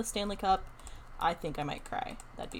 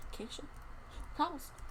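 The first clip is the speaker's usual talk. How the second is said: whispered, much softer repeat of the choice; whispered question; 5 semitones higher, 10 dB quieter than usual. Pretend. Streamer asks viewers again which block, whispered.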